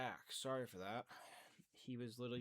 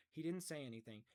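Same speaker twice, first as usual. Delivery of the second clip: plain reading voice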